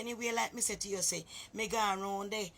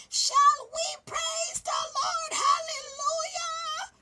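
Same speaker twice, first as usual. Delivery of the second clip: very high, loud, slow, wailing church shout; drawn-out ecstatic screech of praise; shrieking hallelujah; 8 dB louder, much slower than usual. Retold or imitated. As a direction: scream it out like a child possessed by the spirit. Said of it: "me shout my little voice".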